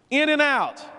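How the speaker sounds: punchy call. Real, not acted. Punchy pitch to trainees.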